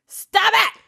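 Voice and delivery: high-pitched mock-mad yell; mock angry outburst. Nani gets mad with her coffee cup, mock angry outburst. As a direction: imitate a furious nanny telling someone off. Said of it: "gets real, real mad now".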